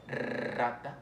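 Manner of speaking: rata with a long rolled R at the start; "rata", drawn-out multiple trill on the R. Shown as a demonstration. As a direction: roll the R hard in rata. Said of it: "que la lengua siempre".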